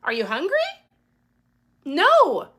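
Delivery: loud, high, flat-out refusal; exasperated loud no to a client; emphatic no. Retold or imitated. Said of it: "And I'm like".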